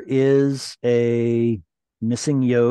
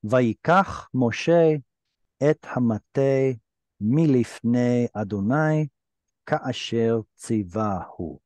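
slow, syllable by syllable Hebrew reading; slow careful reading; much slower than usual. Shown as a demonstration. Teacher models the verse for the student, slow and careful. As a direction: read the verse out slowly and carefully. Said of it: "let me read through one, one time".

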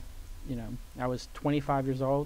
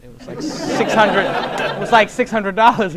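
laughing; 7 semitones higher, 11 dB louder than usual. Real, not acted. Laughing while telling about the ticket.

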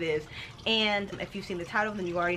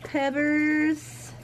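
cooing pet name; 7 semitones higher, 3 dB louder than usual. Acted. Cooing to doll.

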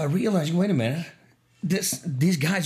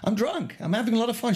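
put-on high, happy drunk voice; carefree tipsy tone; cheerful drunk; 9 semitones higher than usual. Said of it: "I said".

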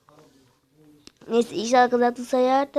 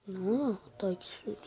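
whisper; 3 semitones lower, 9 dB quieter than usual. Real, not acted. Startled whisper during match.

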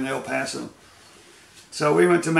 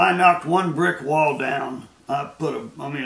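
ordinary talk

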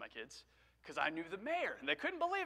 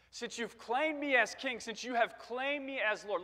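higher, slow and declarative; raised, deliberate preaching delivery; emphatic divine promise; 6 semitones higher than usual. Acted. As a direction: proclaim it with weight, word by word. Same promise repeated with force.

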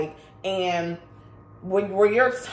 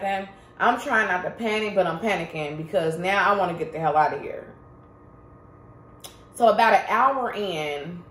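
ordinary talk

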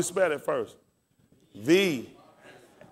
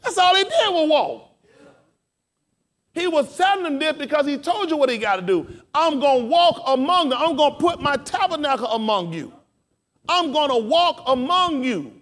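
full-force preaching, high and loud; 12 semitones higher, 7 dB louder than usual. Real, not acted. Preacher telling the congregation he will walk among them, preaching. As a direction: preach it with driving intensity.

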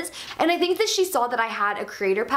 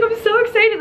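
high and louder, bursting with excitement; freaking out; 10 semitones higher, 5 dB louder than usual. Retold or imitated. Telling viewers how fans made her giddy, high excited squeal. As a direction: squeal it like you can't contain yourself. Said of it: "I was freaking out more than they were".

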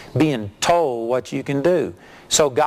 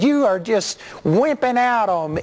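higher-pitched mock taunt; retold goading; 7 semitones higher than usual. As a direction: dare him by shaming him. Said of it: "I began to insult this guy".

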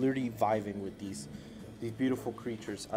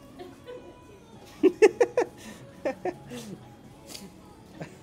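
breathless high-pitched giggle. Real, not acted. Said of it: "I am very immature".

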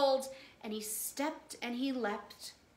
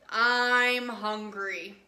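slow, loud whale voice; hungry whale; 7 dB louder, much slower than usual. Acted. The whale demanding food from the last fish, slow and loud. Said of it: "The whale stood up on his tail and said".

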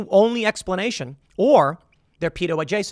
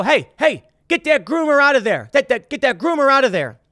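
raised, higher voice yelling across a playground; calling out; 8 semitones higher, 6 dB louder than usual. Retold or imitated. Playground parent yelling to get the groomer out, loud. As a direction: call him out loud and high.

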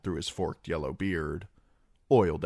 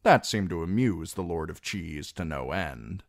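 plain reading voice

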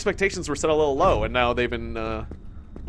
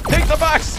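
much higher, strained battle cry; escalated taunt; 11 semitones higher, 4 dB louder than usual. Pretend. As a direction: push it up into a gleeful yell.

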